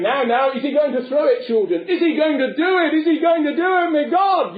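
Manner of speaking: high, rising fretful voice; mock alarmed butler. Butler frets that Punch will throw the baby, high mock alarm. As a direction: build into hammed-up panic.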